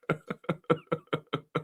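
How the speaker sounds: breathless wheezing laugh; helpless laugh. Real, not acted. Viewer's joke about Powell sets him off laughing. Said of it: "don't make me laugh".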